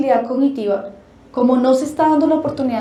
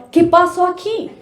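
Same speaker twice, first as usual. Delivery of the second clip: ordinary talk, nothing marked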